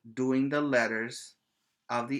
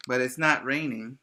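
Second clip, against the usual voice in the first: ordinary talk, nothing marked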